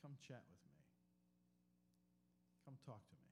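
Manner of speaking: soft, low and inviting; gentle invitation. Acted. Jesus softly inviting Peter to talk after breakfast. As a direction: draw him in quietly.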